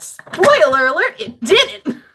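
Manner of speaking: big, loud sarcastic punchline; spring the spoiler with a triumphant jab; mock dramatic reveal. Real, not acted. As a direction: spoiler alert shouted with a sarcastic grin. Sarcastic punchline on failed war.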